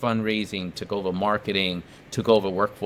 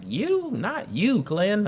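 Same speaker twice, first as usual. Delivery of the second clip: high, incredulous imitated senator; imitating the senator's startled voice; senator's surprise; 10 semitones higher, much slower than usual. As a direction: do his shocked disbelief, pitched way up. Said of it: "I'm trying to do his voice".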